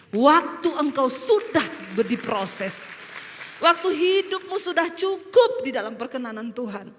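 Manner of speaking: loud, emphatic proclamation; full-force preaching. Real, not acted. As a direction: drive each phrase home to the congregation, stretched words.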